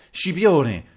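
loud, high call to someone. A narrator's reading. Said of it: "chiamò Terenzio".

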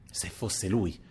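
soft, inward musing; hushed private wondering; said to oneself. A narrator's reading. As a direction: think it aloud, voice dropped. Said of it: "dissi tra me".

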